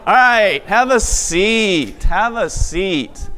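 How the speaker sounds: bright event host. Acted. Calls crowd back to seats.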